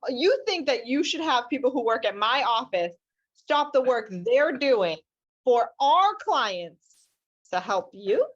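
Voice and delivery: emphatic, slowing to spell out the absurdity; incredulous indignation. Retold or imitated. Lawyer on opposing client using her staff, incredulous indignation. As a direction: lay out each phrase with indignant weight.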